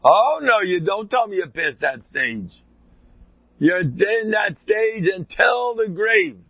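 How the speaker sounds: loud, high-pitched acted rebuke; retold yell. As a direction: jump in yelling a rebuke, stretching out the last words. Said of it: "he yells at him".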